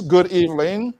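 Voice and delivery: ordinary talk, nothing marked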